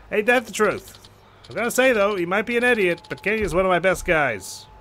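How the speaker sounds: lightly voiced, urgent caller; a hint of a younger-brother type; concerned club manager. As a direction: report trouble to a trusted friend. Voices Yuya on the phone.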